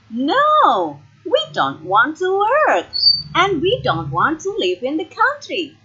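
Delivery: high-pitched, petulant protest; indignant whine, pitch way up; whining refusal. A narrator's reading. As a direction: refuse like a spoilt sister. Sisters refuse to work, then cry.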